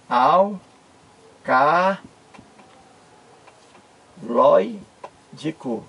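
"Alcaloídico" split as al... ca... loi... dico, long pauses between; syllable by syllable. Shown as a demonstration. Teacher separating the syllables for viewers, "Alcaloídico" in isolated pieces. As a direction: say "Alcaloídico" very slowly, each syllable on its own. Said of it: "vamos separar aqui a sílaba".

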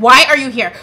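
angry question at the gate; retold angry confrontation. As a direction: confront the caseworker, heated. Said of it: "I am cussing the girl".

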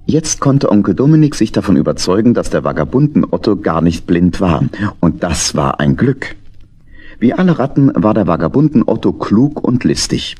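ordinary talk

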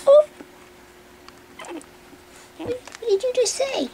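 high squeal. Real, not acted. Owner squealing at the puppy's noise. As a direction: squeak it out in delight.

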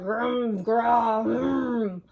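loud, rolling bear growls; bear growling. A narrator's reading. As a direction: growl in waves like a storybook bear. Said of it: "Old Bear growled".

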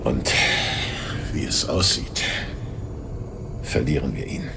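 low, soft, resigned words; quiet admission. Acted. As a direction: let the defeat sink in quietly. Vater gesteht Niederlage. (leises, resigniertes Eingeständnis)

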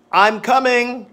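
high, loud answer from another room; loud call back. Shown as a demonstration. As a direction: shout back you're on your way. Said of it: "flashcard drill demo".

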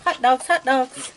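loud, high sing-song chant to the animals; sing-song calling. Real, not acted. Calling the raccoons to come get hot dogs, loud sing-song. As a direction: call it out like ringing a dinner bell.

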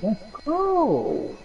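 high drawn-out victory cheer; excited cheer. Real, not acted. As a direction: hype it up like you just got the loot. Hyped after weapon upgrade.

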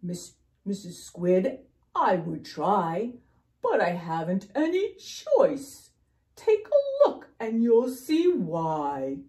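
pout-pout fish making loud excuses; glum, helpless fish. Acted. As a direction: protest that you're stuck, with feeling. Voices the fish's reply.

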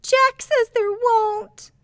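put-on a small girl complaining through tears; tearful little girl. A narrator's reading. Little sister tells on her brother, tearful high child voice.

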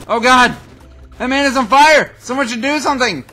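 mock alarm. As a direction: cry for help, hammed-up. Man on fire, alarm.

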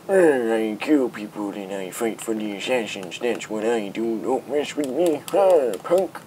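hammed-up tough-guy assassin boast; mock old assassin.

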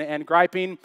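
ordinary delivery

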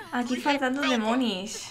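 ordinary talk, nothing marked

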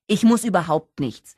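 loud, emphatic comeback; defiant retort. A narrator's reading. Holly refusing Gary's insistence, loud defiance. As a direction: throw it back at him forcefully.

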